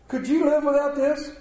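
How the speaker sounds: high-pitched rhetorical question; posed question. Real, not acted. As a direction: put it to the congregation, pitched high. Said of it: "Let me ask you a question".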